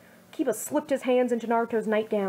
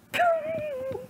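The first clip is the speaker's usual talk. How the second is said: nervous high giggle. Acted. Nervous laugh before asking.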